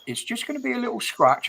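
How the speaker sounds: high, quick sing-song nurse impression; mocking nurse. Patient mimicking nurses' chirpy "little scratch" line. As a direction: parrot the nurse's breezy reassurance. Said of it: "And every time it's".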